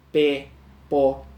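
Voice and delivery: pé and pó hit hard and loud; stressed monosyllables pé, pó said loudly; tonic stress demo. Shown as a demonstration. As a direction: punch each monosyllable pé, pó with force. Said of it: "Tônica, com maior força".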